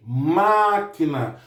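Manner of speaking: "Máquina" very slow, MÁ held long; stress on MÁ. Shown as a demonstration. Teacher repeating it for pupils, long stressed MÁ in "Máquina". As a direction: draw out the first syllable "Má" in "Máquina". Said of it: "a sílaba tônica é o ma".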